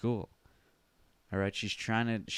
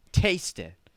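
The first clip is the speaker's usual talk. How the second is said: louder, higher insistence; insistent prompting; 7 semitones higher, 5 dB louder than usual. Acted. Urging doubtful Grandma to try it, loud and insistent. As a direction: press the doubter to do it.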